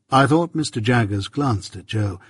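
ordinary delivery

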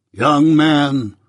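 lightly voiced pompous tradesman; self-important, preachy and grand; pompous old man voice. A narrator's reading. Pumblechook speaks pompously.

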